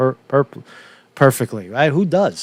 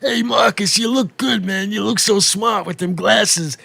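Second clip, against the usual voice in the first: put-on higher, loud, slow tough-guy New York accent; Staten Island mobster; 7 semitones higher, 3 dB louder than usual. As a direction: greet like a Staten Island wiseguy, big and friendly. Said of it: "these are guys like".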